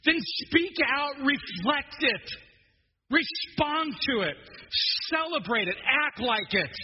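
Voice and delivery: mock-shouting hello McFly at a dozing listener; urgent wake-up call. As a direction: shake them awake, stretching every word. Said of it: "It's, it's like".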